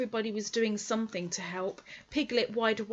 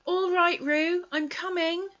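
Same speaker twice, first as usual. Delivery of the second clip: higher, louder call; 10 semitones higher, 9 dB louder than usual. Acted. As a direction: shout across the stream to reassure. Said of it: "called Christopher Robin".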